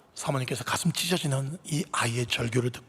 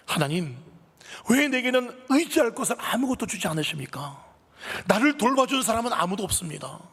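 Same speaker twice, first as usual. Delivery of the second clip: anguished crying out; 10 semitones higher than usual. Acted. Pastor's wife crying out to God in anguished protest. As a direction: cry out to God in despair. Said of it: "이렇게 외쳐요".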